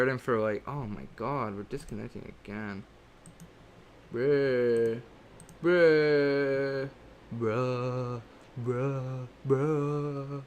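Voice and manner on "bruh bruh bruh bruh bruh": Technoblade bruh. Retold or imitated. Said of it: "As Technoblade would say it".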